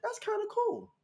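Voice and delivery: high, delighted aside; impressed. Retold or imitated. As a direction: react to discovering something cool. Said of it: "And I was like".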